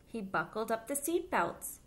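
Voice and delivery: put-on lively storybook voice for little kids; reading to children. A narrator's reading. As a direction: read it aloud like storytime for children. Reads picture book to children.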